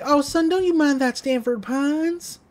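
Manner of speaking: a hint of a soothing Southern dad; Bud Gleeful's voice. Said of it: "And Bud's like".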